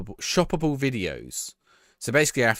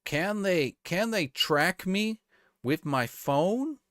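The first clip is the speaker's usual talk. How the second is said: higher, very slow, baffled question; clueless senator; 8 semitones higher, much slower than usual. Retold or imitated. Streamer mocks a senator's question to TikTok, slow and baffled. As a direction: drag it out like a confused old senator.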